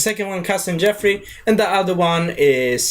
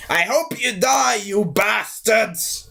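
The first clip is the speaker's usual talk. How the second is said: put-on slow drunken curse; 5 semitones higher, slower than usual. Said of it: "he's saying".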